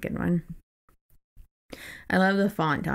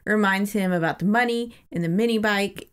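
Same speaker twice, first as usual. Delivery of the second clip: usual speaking voice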